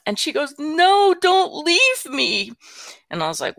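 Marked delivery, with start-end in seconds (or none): 0.6-2.5 mock wail, high and drawn out; sobbing child, imitated. Granddaughter begging grandma not to leave, mock sobbing plea. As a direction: imitate a distraught little girl pleading, high-pitched. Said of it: "the younger granddaughter starts, like, sobbing".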